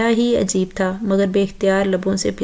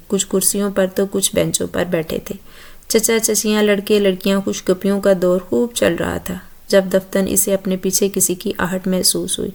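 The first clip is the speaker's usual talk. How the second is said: ordinary delivery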